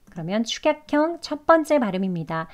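ordinary talk, nothing marked

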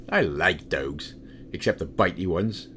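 ordinary talk, nothing marked

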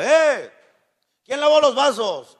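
a high, sharp mock yell; father yelling. Angry father yelling about smelly glasses. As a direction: call out like an angry dad. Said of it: "el señor grita".

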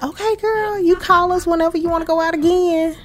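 high-pitched, quick friendly goodbye voice; bubbly high voice, rushed; high mock sendoff. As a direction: chirp it like eager friends waving her off. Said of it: "They like".

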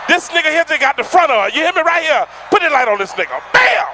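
loud, high ribbing of a flashy suit; hyped-up crowd roast at full volume; mock roast shout. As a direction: yell it out like calling a guy out in the crowd.

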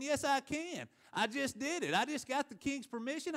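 high-pitched, insistent acted retort; defiant confidence. Nehemiah answering everybody who says he can't, defiant.

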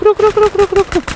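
very high rapid-fire repeated stop calls; frantic squeal. Real, not acted. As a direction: squeal it in a panicked, high-pitched burst. Frantic shouting to stop in game.

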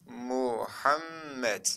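"Muhammed" drawn out with a long doubled M; example word. Shown as a demonstration. Teacher gives students an example, slow model reading. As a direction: sound out "Muhammed" syllable by syllable. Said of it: "Mesela örnek verelim".

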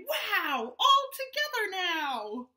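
rally everyone to dance; Frog cries out. Acted. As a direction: Frog calling all animals to dance together. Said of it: "Frog cried".

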